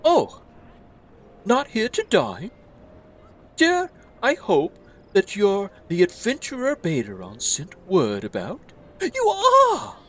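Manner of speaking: high, theatrical elf innkeeper; overjoyed fantasy innkeeper voice; gushing elf innkeeper. Acted.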